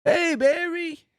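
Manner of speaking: very high, slow, odd voice; a squeaky jogger calls out; high slow squeaky Artie; Artie greeting. Acted.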